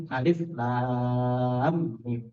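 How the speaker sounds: "Alif, lam, mim" with "lam" held very long, low and level; recitation demonstration. Shown as a demonstration. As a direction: stretch "lam" slowly and glide into "mim". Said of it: "cara membacanya adalah seperti ini". ("Alif, lam, mim" dengan "lam" dibaca sangat panjang)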